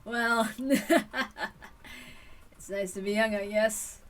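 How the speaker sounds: slow amused chuckle through words; an old man chuckling at youth. Acted.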